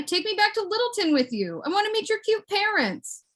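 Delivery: high-pitched coaxing; high pleading. Pretend. Begging baby guy to take them home, high pleading. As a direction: beg sweetly, pitched well up.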